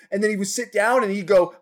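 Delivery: normal talking voice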